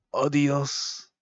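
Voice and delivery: drawn-out attempt at Spanish. Shown as a demonstration. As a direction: try a stretched Spanish goodbye.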